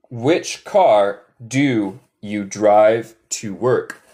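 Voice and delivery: slow, separated words; slow model question. Shown as a demonstration. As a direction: model it word by word for learners. Teacher models full question.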